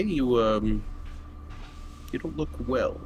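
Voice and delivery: ordinary talk, nothing marked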